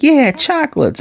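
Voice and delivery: high-pitched eager mimicry; eager reply. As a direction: imitate a delighted kid promised treats. Acted excited reply to chocolates.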